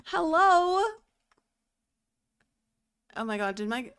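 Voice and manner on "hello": exasperated call. Real, not acted. Computer froze, calling out.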